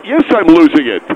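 loud, defiant outburst; hammed-up meltdown; angry retort. Acted retort to being told losing it.

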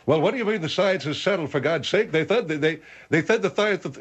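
high-pitched lisp, stumbling over the words. Acted. General objecting to the settled science, starting to lisp. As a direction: protest with a thickening lisp. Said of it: "You're starting to lisp".

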